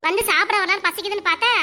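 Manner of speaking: ordinary talk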